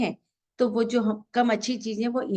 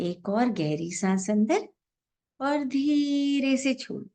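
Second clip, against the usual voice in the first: guided meditation; 3 semitones lower than usual. Real, not acted. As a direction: guide each breath gently, drawing out the exhale cues. Leads a breathing meditation.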